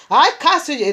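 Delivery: commanding cast. Retold or imitated. Retold casting out of demons.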